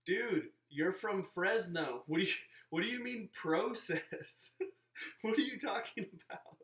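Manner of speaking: loud, high, incredulous outburst breaking into a laugh; exasperated mock rebuke. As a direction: call them out in exaggerated disbelief. Exasperated rebuke of pretentious acquaintance.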